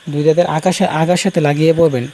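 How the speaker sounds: normal talking voice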